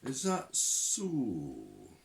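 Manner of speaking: very slow, suspicious drawl; old witchhunter doubting the young caller; Shadwell, drawn out. Acted. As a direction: stretch each word, doubting him. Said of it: "said Shadwell".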